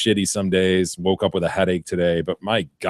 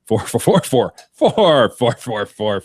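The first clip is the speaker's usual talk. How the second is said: speaking only in fours, with rising and falling inflection; imitating the four man; 7 semitones higher, 7 dB louder than usual. Guy with a head injury chatting away in fours, with full inflection. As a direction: mimic a conversation made only of the word four. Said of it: "He'd have an entire conversation, voice inflection".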